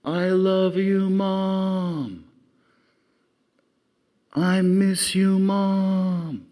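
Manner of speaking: long, high, drawn-out shout into the distance; yelling to their moms. Retold or imitated. Two kids on the summit calling out to their moms: long high yell. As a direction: call it out across the valley, stretched slow. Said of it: "they yell out".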